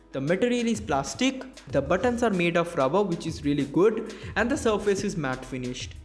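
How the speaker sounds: normal talking voice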